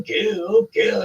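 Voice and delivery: high-pitched manic chant; mock yelling. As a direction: yelp it high, half-performed frenzy.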